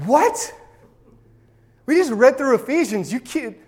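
high, incredulous outburst; stunned, pitched right up. Acted. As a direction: blurt it out in high-pitched disbelief. Reacts in disbelief to the quote.

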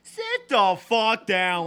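slow, loud, furious command; angry shout. Retold or imitated. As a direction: bark the order slowly. Angry outburst at the kid.

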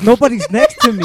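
high, loud protest through laughter; laughing mock protest. Student retelling his answer to Miss Jones, laughing protest. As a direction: protest it incredulously, cracking up.

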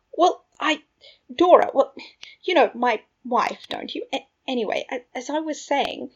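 halting, high and stumbling; flustered babble. A narrator's reading. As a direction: trip over an awkward explanation. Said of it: "interrupting Remus's babble".

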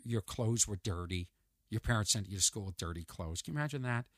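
low, soft, reassuring talk; gentle counselling. Retold or imitated. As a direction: take a student aside and reason quietly. Voicing adult gently counselling a student.

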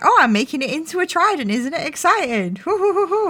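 playfully hammed-up excitement; mock excitement. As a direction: gush it, ending in high hoots. Acting out a character's gleeful tinkering.

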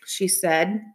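ordinary delivery, nothing marked

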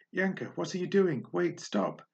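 high startled call after her; alarmed cry. Acted. Sasha alarmed as she floats away.

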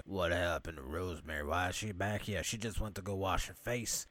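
pimp-looking guy. Retold or imitated. Tony asks about Rosemary's absence, low mock guy voice. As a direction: drop low for a put-on tough guy. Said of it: "the pimp-looking guy".

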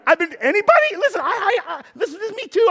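high excited preacher's confession; excited outburst. Real, not acted. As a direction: blurt it out to the room.

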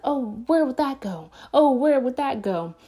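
high, singled-out mock wondering; feigned curiosity. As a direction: play innocently puzzled, pitch lifted. Feigned puzzling to distract the captor.

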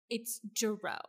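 low, soft deadpan correction. Retold or imitated. Co-host echoes the wife's surname correction, deadpan. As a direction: state the name with quiet finality.

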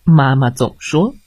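ordinary delivery, nothing marked

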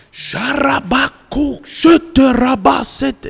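very high, loud, slow gibberish yell; imitated screaming man. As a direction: mimic the man on the floor screaming nonsense. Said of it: "tinha um cara no chão gritando". (imitando um homem gritando em línguas, bem agudo e alto)

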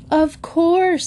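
high, drawn-out burst of relief; big relief. A narrator's reading. Mother hears of someone who can help, hugely relieved. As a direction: let it out high as sudden relief. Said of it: "such a big sigh of relief".

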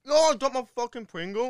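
high loud frustrated yell; outraged shout. Real, not acted. Dropped his crisp.

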